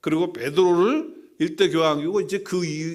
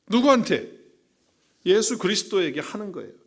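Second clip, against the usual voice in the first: fiery preaching; 6 semitones higher, 4 dB louder than usual. Acted. Pastor pressing the congregation about the church's foundation, fiery preaching. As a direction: challenge the listeners with each question.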